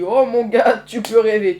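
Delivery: mocking refusal. Mocking Herschel's request.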